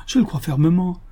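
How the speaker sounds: high, firm declaration of belief; exclaimed with conviction. A narrator's reading. Good lady affirming providence, exclaimed with conviction. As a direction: insist on it with full faith. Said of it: "s'écria la brave femme avec conviction".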